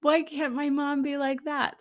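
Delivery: put-on laughing little girl's wistful wish; child longing. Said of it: "And I was like".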